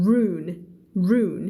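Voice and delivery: "ruin" as roon with a barely-there "in"; correct reading of ruin. Shown as a demonstration. Teacher showing the word without ed, "ruin" as roon. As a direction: run "ruin" together into one falling syllable. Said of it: "มันอ่านว่า".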